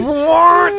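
high, loud yelp of surprise; shocked "what". Retold or imitated. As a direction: blurt it out, stunned. Mocking a shocked mate.

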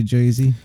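Jersey lightly said as "Joy-zee"; old-timer pronunciation. Retold or imitated. Co-host quoting old Jersey City folk, Jersey as "Joy-zee". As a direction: slip an "oy" into Jersey. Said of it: "people from the fifties and sixties".

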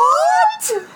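high, loud shocked squeal; shocked what. Retold or imitated.

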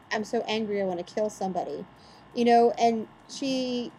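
ordinary talk, nothing marked

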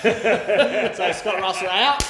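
slow, high, loud through laughter; laughing. Real, not acted. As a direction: laugh the words out. Laughing while telling dog story.

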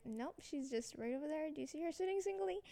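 soft, secretive retold voice; hushed tip-off. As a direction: point her out under your breath. Friend secretly points out mom.

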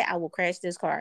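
low, understated threat as her husband; imitating stern husband. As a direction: quote his threat low and level. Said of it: "He said it just like that".

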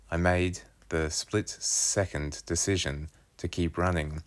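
usual speaking voice

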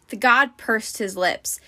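plain reading voice

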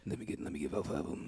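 quick, soft, furtive mumble; hushed imitation. Greedy customer asking for five pizzas, hushed. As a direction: rush the request out under the breath. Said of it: "like, whisper".

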